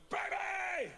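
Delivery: high, excited shout; retold over-excited greeting yell; excited dad. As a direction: burst in yelling for your kid. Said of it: "dads who's very excited".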